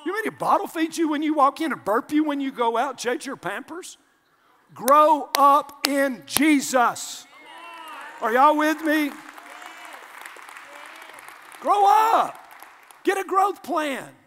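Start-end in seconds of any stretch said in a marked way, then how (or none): 4.7-14.1 loud, slow, high exhortation; preaching shout. Acted. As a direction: thunder at the flock to grow up.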